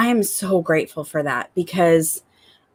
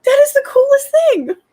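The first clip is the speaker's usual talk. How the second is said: high, loud gushing; thrilled amazement; 6 dB louder than usual. Retold or imitated. Mom answering her excited little girl, thrilled. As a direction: squeal it with delight. Mom gushes back at daughter.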